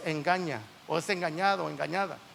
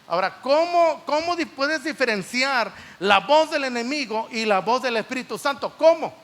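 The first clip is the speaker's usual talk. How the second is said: raised, louder preacher's challenge; emphatic preaching; 5 semitones higher, 6 dB louder than usual. Real, not acted. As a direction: press the congregation with high, insistent questions. Preacher raises voice to question congregation. (predicación enfática, con voz alta y aguda)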